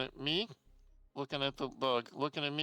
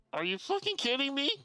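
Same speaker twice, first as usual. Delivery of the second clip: exasperated outburst; 9 semitones higher than usual. Retold or imitated. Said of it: "I'm like".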